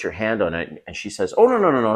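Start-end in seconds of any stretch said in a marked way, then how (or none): 1.4-1.9 higher, brisk brush-off; mock girlfriend voice. Girlfriend stopping her man from opening the can, high brush-off. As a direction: wave him off quickly.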